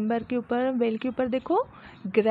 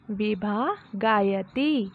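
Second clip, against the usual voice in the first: slow model reading; slower than usual. Shown as a demonstration. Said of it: "teacher reads Sanskrit slowly".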